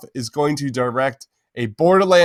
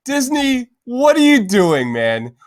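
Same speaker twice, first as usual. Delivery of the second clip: mock scolding; 10 semitones higher, 4 dB louder than usual.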